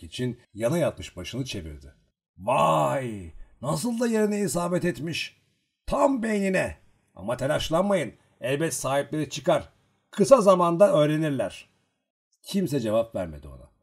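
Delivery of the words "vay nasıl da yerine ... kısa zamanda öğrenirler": high, loud character outburst; raised, emphatic storybook dialogue; loud exclaiming. A narrator's reading. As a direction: exclaim over a dead-on hit, voice raised. Exclaims over the shot man's wound.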